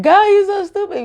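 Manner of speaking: very high, gleeful mock insult; excitement. Shown as a demonstration. Someone insulting God to show the energy, excited. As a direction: squeal it with delighted energy. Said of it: "you said it with this excitement".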